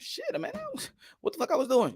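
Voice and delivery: usual speaking voice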